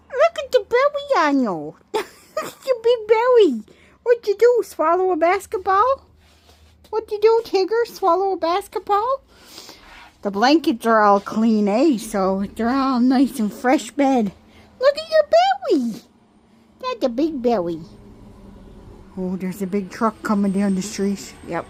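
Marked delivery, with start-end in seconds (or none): 0.1-9.2 very high, loud teasing coo. Real, not acted. Teasing the cat in baby talk. 14.8-17.8 squeaky high coo, much louder. Real, not acted. Baby talk to the cat.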